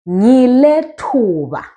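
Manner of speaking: "Ngile thuba" slow and drawn out; slow teacher's demo of "Ngile thuba"; model pronunciation. Shown as a demonstration. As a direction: stretch the vowels of "Ngile thuba" for learners. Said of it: "Then you say".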